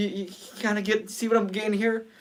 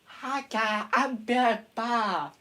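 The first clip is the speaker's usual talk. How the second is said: higher, slow cheery animatronic greeting; Billy Bob voice; 6 semitones higher, slower than usual. Shown as a demonstration. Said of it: "Like that".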